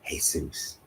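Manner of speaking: soft "Jesus" said as hay-SOOS; "Jesus" with a breathy H, stressed on the last syllable; Spanish pronunciation. Shown as a demonstration. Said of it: "that's why y'all saying".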